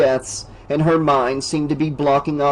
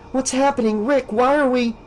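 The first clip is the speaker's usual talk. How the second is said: Alice pleading; 10 semitones higher than usual. Acted.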